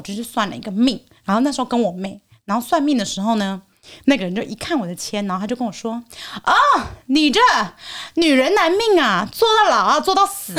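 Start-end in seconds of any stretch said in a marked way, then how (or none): 6.4-10.5 doom-laden fortune teller. Retold or imitated. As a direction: intone it like a gloomy fortune teller. Fortune teller pronouncing her fate.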